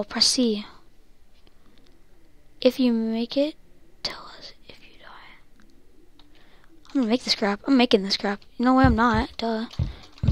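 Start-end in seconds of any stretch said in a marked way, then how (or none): 4.0-6.2 slow hushed whisper. Real, not acted. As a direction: whisper it to the viewers. Whisper aside to viewers.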